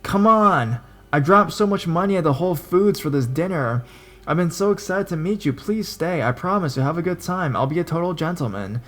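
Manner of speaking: high, pleading sales pitch; laying it on thick. Retold or imitated. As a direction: beg her to stay, overdoing it. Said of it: "He lays it on thick".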